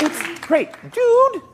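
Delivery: very high mock falsetto; squeaky imitation. Imitating a co-star's catchphrase, squeaky. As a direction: pop it up into a squeak. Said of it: "He's like".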